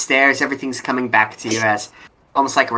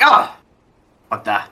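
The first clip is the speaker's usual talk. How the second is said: startled. Acted. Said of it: "you just hear, like".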